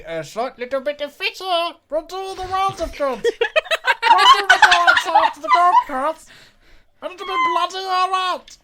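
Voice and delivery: helium voice. Acted. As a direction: squeak out the lines while laughing. Character talking on helium.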